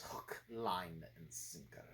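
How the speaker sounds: low, hushed villain impression; Jeremy Irons. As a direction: drop deep and soft, like a movie villain. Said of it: "Jeremy Irons does play this, like, so well".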